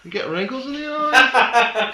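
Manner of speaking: slow, high Midlands parody; put-on Midlands accent. Co-host joining the killjoy act, put-on Midlands accent. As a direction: join in with a drawn-out, high mock Midlands voice. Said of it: "do the whole podcast in that accent".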